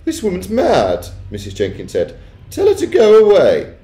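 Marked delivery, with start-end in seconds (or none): 0.1-1.1 high, loud, drawn-out scorn; high-voiced, slow disdain; scornful Missus Jenkins. A narrator's reading. Wife dismissing grandmother as mad. 2.5-3.7 high, loud dismissal; haughty, high-pitched command; imperious Missus Jenkins. A narrator's reading. Wife ordering the woman gone.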